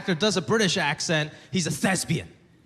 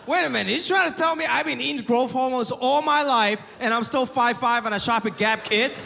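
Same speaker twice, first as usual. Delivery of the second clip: higher, mock-outraged disbelief; incredulous rant; 7 semitones higher than usual. Mock-outraged rant at his friend about hormones. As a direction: pitch it up in comic disbelief.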